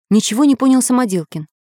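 ordinary delivery, nothing marked

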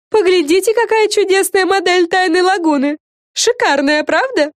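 high, loud, thrilled fairy voice; excited storybook exclamation; enthusiastic exclaiming. A narrator's reading. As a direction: show it off with high-pitched delight. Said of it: "воскликнула Френ".

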